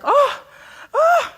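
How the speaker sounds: high, loud imitated TV moaning; hammed-up porn-style moan, way up high; mock moan. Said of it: "those women would moan like".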